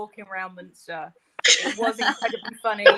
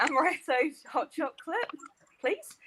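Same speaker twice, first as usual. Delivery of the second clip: put-on tentative German-accented order, raised pitch; quiet, higher mock German accent; best German accent; 6 semitones higher, 4 dB quieter than usual. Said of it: "on my best German accent".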